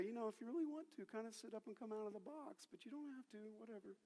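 very soft, offhand mumbled suggestion; mock half-hearted. Preacher parodying what Jesus didn't say to the boy, half-hearted. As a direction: make it a noncommittal, throwaway invitation. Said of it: "Because Jesus didn't look at the boy and say".

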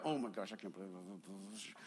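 very low, soft grumbling; muttered disbelief. Retold or imitated. Customer worked up over his burrito, low muttered disbelief. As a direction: seethe under the breath. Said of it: "Like, I was like".

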